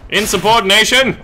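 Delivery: a hammed-up drill sergeant roar; mock officer shout. Playing an officer scolding his men, yelled.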